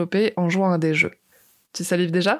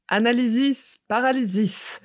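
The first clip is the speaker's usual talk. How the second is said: lightly chanted spell, a bit higher; spell incantation; 4 semitones higher than usual. Pretend. Host casting a mock Harry Potter spell, lightly chanted. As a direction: cast it like a wizard with a wand. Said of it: "un geste avec une baguette imaginaire".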